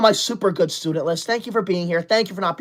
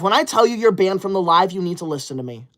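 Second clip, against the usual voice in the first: ordinary talk, nothing marked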